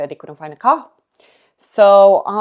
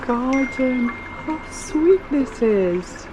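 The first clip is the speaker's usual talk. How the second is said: higher, very slow admiring sigh of words; slow, high, tender admiration; awed delight; 6 semitones higher, much slower than usual. Real, not acted. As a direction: savour each word like a treat. Admiring the garden.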